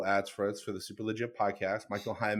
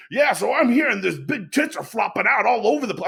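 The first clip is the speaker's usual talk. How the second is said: high, loud excited ramble; lightly voiced Bert mid-tangent; breathless over-the-top storyteller; mock Bert rambling; 11 semitones higher, 7 dB louder than usual. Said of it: "The next thing is".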